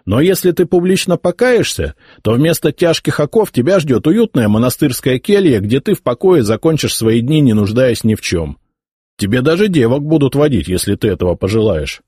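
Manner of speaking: lightly voiced scornful heir; arrogant young emperor. A narrator's reading. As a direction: order the old bishop away with disdain. Nephew voiced, mocking mercy.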